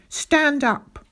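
high, commanding order; shouted. A narrator's reading. Captain orders pupil to his feet, shouted. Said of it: "he shouted".

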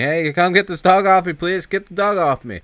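dog-bitten thief begging, mock yell. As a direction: beg someone to call off the dog. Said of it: "yelling and screaming".

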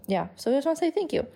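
normal talking voice

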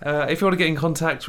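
normal talking voice

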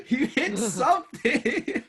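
high, slow laughing mockery; mocking laugh. Real, not acted. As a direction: echo him back, laughing at him. Mocking echo of player.

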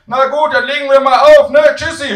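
high, booming sign-off; shouting granny. Acted. Grandmother ending the phone call, high loud shout. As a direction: holler goodbye, easing only on the last word. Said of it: "schreit Oma".

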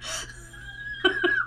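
squealing giggle; high laugh. Real, not acted. Laughs at herself.

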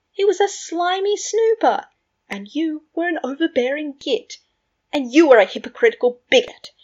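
loud, high-pitched bickering; indignant, forceful name-calling; heated insults. A narrator's reading. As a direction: hurl insults in a rising quarrel. Friends trade insults in a quarrel.

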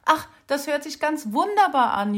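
höher und lauter, freudig jubelnd. A narrator's reading. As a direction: juble vor Freude über die neue Wohnung. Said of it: "jubelte das Eichhörnchen". (Eichhörnchen jubelt über die Wohnung, freudig)

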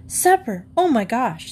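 high startled gasp; surprised. Acted. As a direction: catch yourself in sudden alarm. Girl realizes she forgot.

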